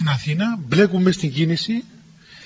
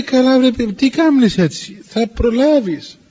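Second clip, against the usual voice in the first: higher, louder, drawn-out mock chiding; easygoing protest; 5 semitones higher, 4 dB louder, slower than usual. Elder to the worried abbess who must leave, easygoing protest. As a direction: stretch it out, telling them there is no rush.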